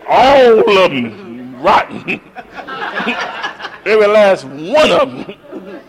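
drawn-out grandma condemning the family; scolding grandma. Retold or imitated. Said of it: "she talked just like that".

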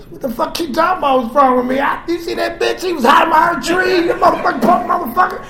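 high, fast yelling in a rage; retold wild shout; furious yelling. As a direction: scream it at her mid-gunfight. Retold shooting, yelling at wife.

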